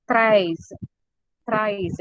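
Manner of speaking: "Thrice" said as French treize, rolled r, ending in z. Shown as a demonstration. Teacher drilling treize, not English thrice. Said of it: "You will say, no, twice, thrice, once in English".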